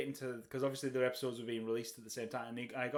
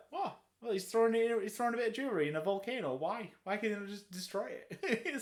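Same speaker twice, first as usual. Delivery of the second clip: high, incredulous mock confusion; baffled viewer; 8 semitones higher than usual. Newcomer watching the third film, high baffled disbelief. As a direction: play someone lost who never saw the earlier films. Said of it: "And you're like".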